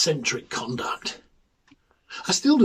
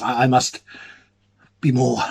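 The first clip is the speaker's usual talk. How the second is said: usual speaking voice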